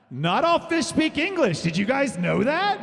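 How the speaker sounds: high, slow, loud mock lecture; high slow mock-teacher voice. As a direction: spell it out to the slow crowd.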